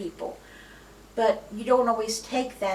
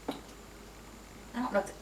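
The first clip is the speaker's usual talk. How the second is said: whispered aside; 2 semitones higher, 10 dB quieter, much slower than usual. Real, not acted. Quiet aside, unvoiced.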